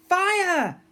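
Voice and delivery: very high, loud scratchy shout; shouted order. A narrator's reading. First mate giving the fire command, loud high shout. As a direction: yell it like a cannon order.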